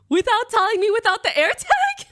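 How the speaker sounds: high, loud incredulous cry; outraged, can't believe it; shocked disbelief. Retold or imitated. Owner hearing her cat was taken, loud shock.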